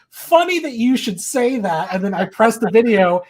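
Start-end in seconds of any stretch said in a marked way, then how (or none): 0.1-1.9 slow, pointed, higher and louder; smug setup line; knowing sly remark. Retold or imitated. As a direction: tease with a knowing hint. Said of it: "I'm like".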